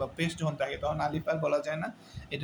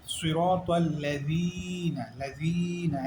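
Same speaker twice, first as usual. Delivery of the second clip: "লাযীনা" with its zii held long and steady; one-alif elongation; much slower than usual. Shown as a demonstration. Teacher showing students the one-alif stretch, "লাযীনা" held long. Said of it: "এক আলিফ টেনে যাবে".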